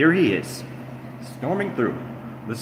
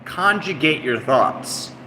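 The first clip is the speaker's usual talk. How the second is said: mocking read; 4 semitones higher, 3 dB louder, slower than usual. Reading chat mockingly.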